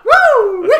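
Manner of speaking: very high, loud whoop; excited woo. Real, not acted. Friend cheering amid the laughter, high whoop. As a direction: let out a piercing party cheer.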